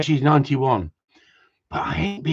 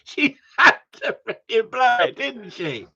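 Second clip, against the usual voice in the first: high-pitched complaint, starting loud; exasperated shouting; 10 semitones higher, 3 dB louder than usual. Real, not acted. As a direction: raise your voice in exasperation, then drop off. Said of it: "I don't know why I'm shouting".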